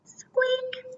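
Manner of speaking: squeaky and high, barely there; a small high squeak, very soft; tiny squeak. A narrator's reading. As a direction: say it like a mouse squeak. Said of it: "a tiny little squeak".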